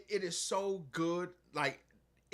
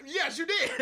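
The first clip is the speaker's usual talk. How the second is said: high, louder, laughing yes; laughing agreement; 10 semitones higher, 4 dB louder than usual. Retold or imitated. Cop confirming the man messed up, high laughing. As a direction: crow it through laughter.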